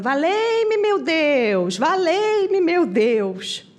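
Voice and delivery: high wailing plea, falling away; mock desperate shout. Chico shouting to God on the plane, high mock wail. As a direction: imitate a panicked cry to heaven. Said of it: "Mas continuava gritando".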